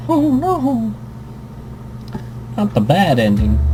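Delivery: usual speaking voice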